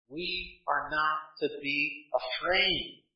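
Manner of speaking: a calm, quiet assurance to the fearful; soft reassurance. Acted. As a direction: lay it down quietly and slowly. Same reply repeated softly.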